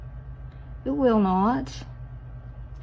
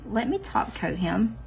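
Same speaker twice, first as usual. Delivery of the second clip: usual speaking voice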